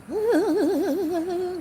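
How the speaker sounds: high, wobbly imitation laugh; long high-pitched mock cackle; mocking stupid laugh. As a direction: mimic a silly laugh, high and fluttering. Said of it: "laughing like you stupidly".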